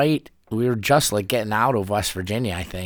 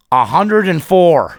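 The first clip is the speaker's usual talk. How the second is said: loud, drawn-out higher announcement; stern cop; 8 semitones higher, 9 dB louder, much slower than usual. Retold or imitated. Cop telling a speeder how fast, stern and loud. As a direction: stretch it out big, like a cop springing the news.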